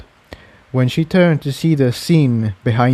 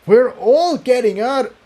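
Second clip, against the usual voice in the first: defiant shout over the roar; 12 semitones higher than usual. Acted. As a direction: make her hear over the fire.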